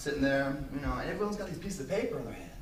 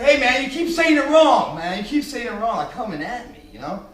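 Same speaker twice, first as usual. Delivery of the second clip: annoyed director; 8 semitones higher, 12 dB louder than usual. Retold or imitated. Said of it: "he's like".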